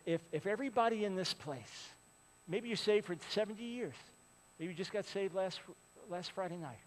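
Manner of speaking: ordinary delivery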